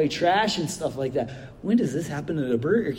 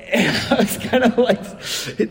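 laughing; 5 semitones higher, slower than usual. Real, not acted. Laughing at the awkward moment.

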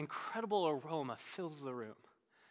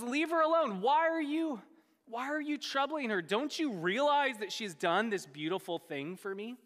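high, pleading rebuke easing down; chiding; 5 semitones higher than usual. Acted. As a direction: defend her with an exasperated scolding. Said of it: "he even chides his disciples".

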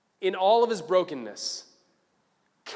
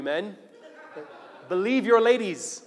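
normal talking voice

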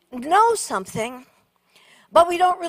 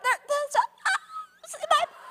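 tiny high squeak, softer; 6 dB quieter than usual. Retold or imitated. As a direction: squeak it out, barely able to speak. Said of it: "I finally squeaked out".

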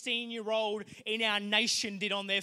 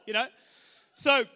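ordinary talk

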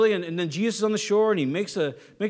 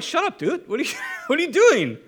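high, louder incredulous protest with a laugh; mock exasperation; 7 semitones higher, 4 dB louder than usual. John telling Peter to shut up, mock exasperated. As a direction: protest in half-laughing disbelief.

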